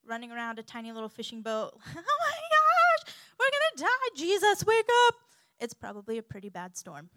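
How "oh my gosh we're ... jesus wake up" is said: hammed-up terror, very high and loud; panicked scream. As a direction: scream for help as if drowning. Acting panicked disciples in the storm.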